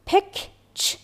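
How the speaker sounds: "پک چ" with the ch sound cut loose; "چ" sounded alone after "پک"; isolated sound demo. Shown as a demonstration. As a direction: say "پک", pause, then only the consonant "چ". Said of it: "این صدای چ".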